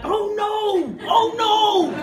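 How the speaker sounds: high, hammed-up cry of oh no; high mock alarm; mock dismayed shout. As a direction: mimic everyone groaning at him. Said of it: "everyone would be like".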